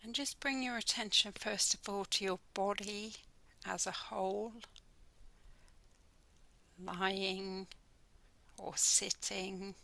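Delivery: measured, calm delivery, letting each phrase settle; guided meditation. Acted. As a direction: guide listeners gently into the body scan, unhurried. Leads a guided body scan meditation.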